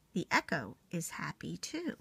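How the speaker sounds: soft echoing whisper; a hushed whisper across the pond. Acted. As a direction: breathe it out like a faint echo. Wise beaver, echo effect whisper.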